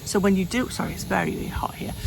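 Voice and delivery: usual speaking voice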